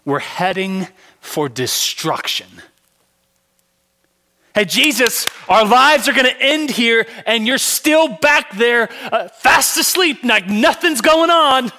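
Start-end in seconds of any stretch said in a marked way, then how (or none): 4.5-11.7 high and loud, pleading in alarm; frightened shouts from the boat; panicked disciples. Acted. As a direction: beg him to wake up. Disciples plead with sleeping Jesus.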